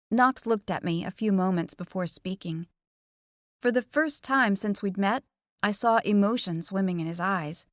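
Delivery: ordinary delivery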